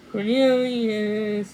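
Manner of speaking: loud, drawn-out shout of his name; fairy queen loudly calling her son; queen's shout. A narrator's reading. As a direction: call your son back across the garden. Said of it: "the fairy queen shouted".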